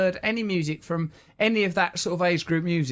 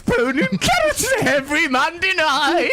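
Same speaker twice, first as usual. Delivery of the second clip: laughing; 8 semitones higher, 4 dB louder than usual. Acted. Horsey bit, laughing.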